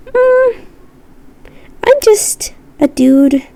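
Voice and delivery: playful squeaker voice; squeaky little guy voice. Pretend. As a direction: squeak it out like a tiny harmless critter. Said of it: "a squeaker".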